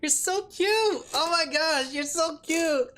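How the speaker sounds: high, slow cooing to a puppy. Real, not acted. Gushing over her dog.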